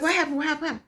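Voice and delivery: high, bewildered what happened; lightly voiced puzzled onlookers; confused people. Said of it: "They're like".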